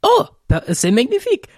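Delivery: high thrilled gush; delighted French exclamation. Acted. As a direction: squeal it out with delight. Player delighted by disguise idea.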